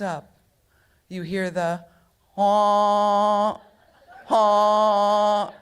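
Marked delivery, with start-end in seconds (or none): 2.3-5.5 loud honking foghorn imitation; imitated ship horn. Storyteller imitating the steamship horn at dawn, loud honk.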